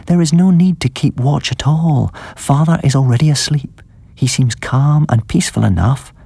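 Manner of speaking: a lightly voiced young woman, gently coaxing; sweetest voice. A narrator's reading. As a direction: sweet-talk him out of keeping watch. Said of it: "in her sweetest voice".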